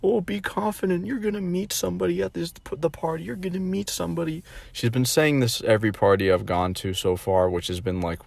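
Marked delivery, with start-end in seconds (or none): 0.0-4.4 high, soft imitation of mom; sweet encouraging mother voice. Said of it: "she's like".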